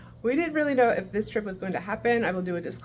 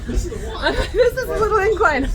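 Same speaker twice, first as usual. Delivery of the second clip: laughing; 7 semitones higher, slower than usual. Real, not acted. Laughing at steep ramp.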